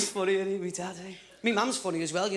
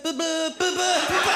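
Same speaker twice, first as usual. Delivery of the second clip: stammering cannon; 7 semitones higher, 6 dB louder than usual. Acted. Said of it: "And the guns went".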